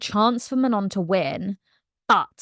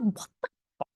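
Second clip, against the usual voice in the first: flat disbelief; 9 dB quieter, faster than usual. Retold or imitated. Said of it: "Manon's like".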